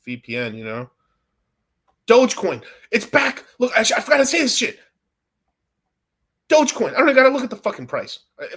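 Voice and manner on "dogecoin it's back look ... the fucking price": louder, higher and fast; excited fast shouting about Dogecoin; hyped. Real, not acted. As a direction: burst out excited about the coin. Sudden hype about Dogecoin.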